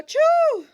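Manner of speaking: high, loud sneeze burst; big sneeze. Acted. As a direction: let the sneeze explode out. Acted sneeze.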